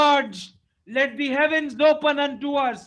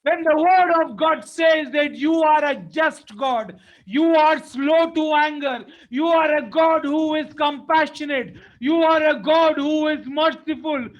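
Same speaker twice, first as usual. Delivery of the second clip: fervent pulpit plea; impassioned preaching; 3 semitones higher, 3 dB louder than usual. Acted. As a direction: preach it like a lament. Preacher pleads at full force.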